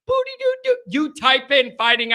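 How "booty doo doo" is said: high little sing-song booty-doo-doo; quick high nonsense syllables for tapping keys; imitated typing noise. Mimics typing into the phone.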